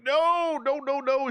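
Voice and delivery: sharp, high, loud no no no; loud protest. Retold or imitated. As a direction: shout it down, alarmed. Protesting the plan to involve Lorian.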